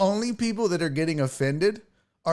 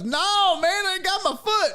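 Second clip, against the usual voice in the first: high-pitched imitated heckler protest; emphatic retort. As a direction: insist back at the comic, voice raised high. Amputee insists back to the comic.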